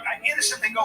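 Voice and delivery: ordinary delivery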